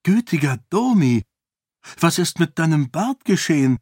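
high, amazed exclamation; astonished. A narrator's reading. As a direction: exclaim in surprised recognition. Startled recognition of her uncle.

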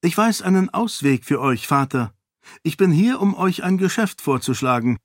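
parry his threat with ease; calm defiant princess. Acted. Sarene offers a deal. (gelassen herausfordernd)

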